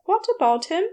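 a hint of a prim, worried angel; Aziraphale's voice. A narrator's reading. Aziraphale answers the shop girl's call, lightly voiced worried gentleman.

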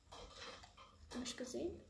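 slow, soft unvoiced whisper. Real, not acted. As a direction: whisper so nobody hears. Whispers while hiding from enemy.

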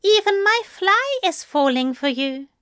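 high, grinning angel voice; mischievous teasing. A narrator's reading. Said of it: "grinned at him mischievously".

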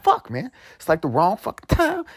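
ordinary delivery

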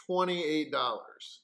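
hammed-up pitchman; mock sales pitch. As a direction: announce it like a special offer.

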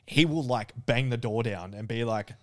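ordinary delivery

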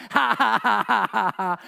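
loud, high taunting ha ha; mocking laugh. Brother taunting his sister with a loud mock laugh. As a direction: laugh in his face on purpose. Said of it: "just starts laughing".

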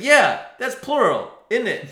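very high, loud insisting retort; mock exasperation. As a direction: argue back at the top of the voice. Acted quarrel over y'all.

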